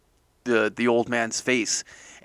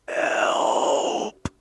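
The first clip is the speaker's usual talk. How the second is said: whisper. Acted. Old man's eerie plea.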